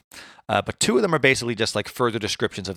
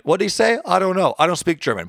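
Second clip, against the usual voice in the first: higher, louder mock confusion; baffled someone; 8 semitones higher, 4 dB louder than usual. Host imitating someone baffled by Kant's German, mock confused. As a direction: act out a clueless translator, pitched up. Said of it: "sounds like someone went".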